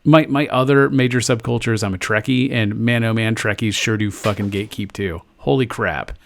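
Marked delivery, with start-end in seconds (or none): none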